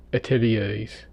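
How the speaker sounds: normal talking voice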